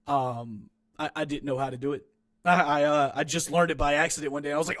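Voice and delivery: ordinary delivery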